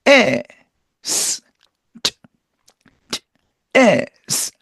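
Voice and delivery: 에 and 스 said apart as separate sounds; separated sounds. Shown as a demonstration. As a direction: sound out 에, 스 one by one. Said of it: "따로따로 발음해서".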